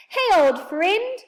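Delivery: high, loud, drawn-out hail; excited yell. A narrator's reading. Saucepan man hailing his old friend below, yelling slowly. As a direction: stretch out the greeting at full voice. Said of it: "in excitement".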